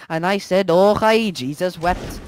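excited Irish brogue, dropping to a hush; hammed-up Irish accent winding down; mock Irish. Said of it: "speaking Irish".

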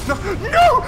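rising shrieks of no; real despair at the screen; anguished shout. Real, not acted. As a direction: howl it as the fight is lost. Boss hit lands.

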